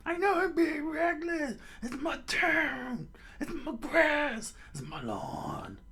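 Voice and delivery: high, drawn-out wail; hammed-up crying protest; tearful defiant old mayor. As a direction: wail each phrase with mounting grief. Mayor cries over his town.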